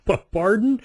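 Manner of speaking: high, stammered disbelief; taken aback. Retold or imitated. Traveler hearing an odd border demand, taken aback. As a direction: stumble on the word, pitched well up.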